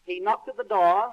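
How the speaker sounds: plain reading voice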